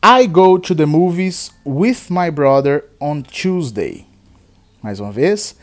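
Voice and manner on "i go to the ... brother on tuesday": slow, careful sentence for students to repeat; slow model sentence. Shown as a demonstration. Teacher models a sentence for the class to repeat. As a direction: read it slowly, word by word. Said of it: "Eu vou falar sempre duas vezes cada frase".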